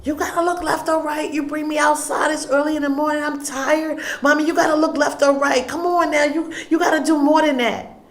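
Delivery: daughter yelling. Retold or imitated. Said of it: "she started yelling at me".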